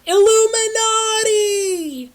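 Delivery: high, loud hammed-up scream. Triss screams her discovery, high and loud. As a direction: shriek it in mock terror. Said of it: "Triss screamed".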